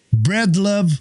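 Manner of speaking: American accent. Shown as a demonstration. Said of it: "Ia și pronunță".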